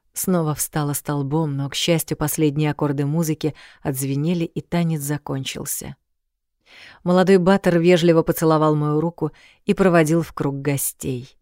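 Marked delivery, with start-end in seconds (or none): none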